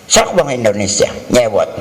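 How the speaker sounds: ordinary talk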